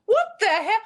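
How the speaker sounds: high, loud, hammed-up disbelief; mock astonishment. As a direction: squeal it as if floored. Acts out amazed reaction to accent.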